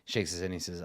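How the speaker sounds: normal talking voice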